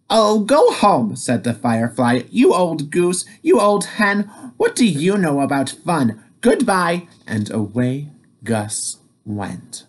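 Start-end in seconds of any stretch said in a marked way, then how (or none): 0.1-1.2 cheeky brush-off. Acted. Firefly dismisses Sam. 2.3-7.1 cheeky taunting. Acted. Firefly taunts Sam.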